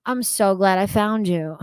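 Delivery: ordinary talk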